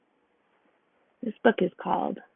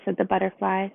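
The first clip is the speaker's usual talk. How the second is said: usual speaking voice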